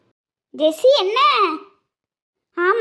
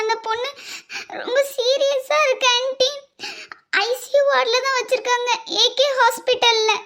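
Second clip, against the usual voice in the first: put-on higher girl's voice, scared and urgent; a frightened girl calling with bad news; higher, anxious young girl's voice; young girl on phone; 5 semitones higher than usual.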